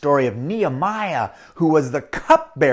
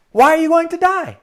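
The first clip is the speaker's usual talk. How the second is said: high, louder alarmed question; shocked master; 12 semitones higher, 6 dB louder than usual. Acted. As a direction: recoil at the news. Master shocked by Chong's news.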